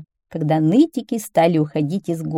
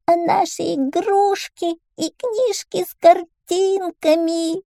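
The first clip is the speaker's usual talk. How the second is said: put-on very high, slow childish wail; tearful child; 12 semitones higher, slower than usual. A narrator's reading. Little whiners missing their toys, crying. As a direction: whimper like a small child in tears. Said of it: "заплакали маленькие нытики".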